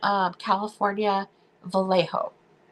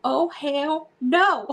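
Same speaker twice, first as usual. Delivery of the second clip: higher, long drawn-out mock outrage; emphatic refusal; 5 semitones higher, much slower than usual. Replaying the lookalike insult for laughs, emphatic refusal. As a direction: stretch each word into a big comic refusal.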